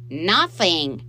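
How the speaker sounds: loud indignant denial; shout. A narrator's reading. Boy denying blame to stepfather, shouting. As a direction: shout it defensively. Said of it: "Michael shouted".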